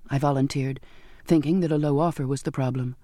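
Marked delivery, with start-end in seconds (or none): none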